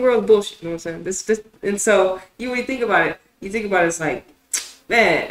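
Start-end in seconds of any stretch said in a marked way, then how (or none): none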